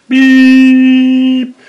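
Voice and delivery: high, loud sung beep tone; imitated beep. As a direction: belt out a test-signal beep. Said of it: "I guess we should have a beep".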